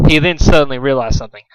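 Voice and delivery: usual speaking voice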